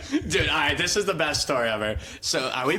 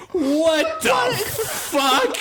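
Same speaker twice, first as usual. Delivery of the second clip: much higher, very slow drawn-out outrage; shocked disbelief; 4 dB louder, much slower than usual. Retold or imitated. Hearing Kylie praised his smile, slow shocked squeal. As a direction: stretch it out in stunned disbelief. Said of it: "And I'm like".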